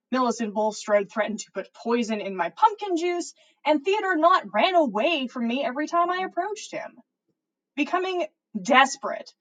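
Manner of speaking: plain reading voice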